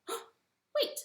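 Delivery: high gasping wait; sudden realization. Acted. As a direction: catch a sudden thought. Anansi realizes.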